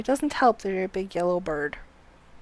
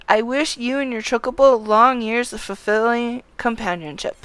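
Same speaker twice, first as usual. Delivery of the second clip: louder, ceremonious game dialogue; formal dialogue read-aloud; 9 dB louder than usual. Acted. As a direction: proclaim it like an official handing over a license. Reads game character's dialogue aloud.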